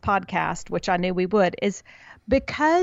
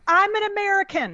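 a much higher mock-indignant Carrie Bradshaw; quoting Carrie Bradshaw; 10 semitones higher than usual. Cohost retelling Sex and the City, high mock indignation. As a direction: pitch it way up, huffy and offended. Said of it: "she was like".

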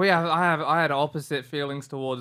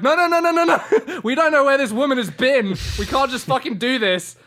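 panicked shouting; 11 semitones higher, 8 dB louder than usual. Retold or imitated. Panicking at himself in dream.

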